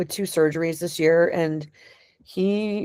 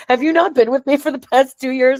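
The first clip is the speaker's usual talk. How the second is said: higher, mock-incredulous retort; joking disbelief; 7 semitones higher, 4 dB louder than usual. Woman kidding her partner about her crying, joking disbelief. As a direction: tease him with exaggerated disbelief. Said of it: "And we were joking".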